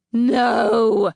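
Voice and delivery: a loud, high furious roar. Acted. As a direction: bellow it at full force. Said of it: "roared Battle Winner".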